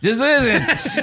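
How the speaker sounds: put-on high, slow drunken slur; hammed-up plastered pleading. Shown as a demonstration. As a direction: demonstrate your drunk voice, dragged out. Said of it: "that's my drunk voice".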